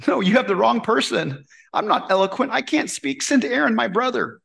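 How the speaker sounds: lightly voiced, anxious refusal; pleading excuse. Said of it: "when God calls Moses".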